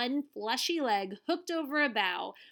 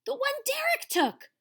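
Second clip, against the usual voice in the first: high, louder burst of relief; relieved; 5 dB louder than usual. A narrator's reading. Tuna tells Wahoo it's the right boat, relieved. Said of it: "Tuna said with relief".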